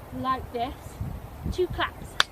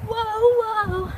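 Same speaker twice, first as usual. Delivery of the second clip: call-out; 9 semitones higher, 6 dB louder than usual. Acted. Jump move call-out.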